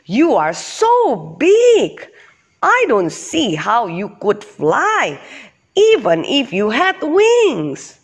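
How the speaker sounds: high-pitched, incredulous beetle; exclaimed disbelief. Acted. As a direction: stretch the words in amazement.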